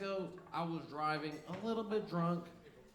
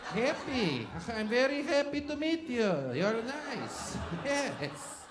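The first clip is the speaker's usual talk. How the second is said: higher, stiff Eastern European accent with no smile; 6 semitones higher, much slower than usual. Shown as a demonstration. Comedian demonstrating how Eastern Europeans greet people, in a stiff higher Eastern European accent. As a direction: play a grim Eastern European greeting a stranger. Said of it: "just like this".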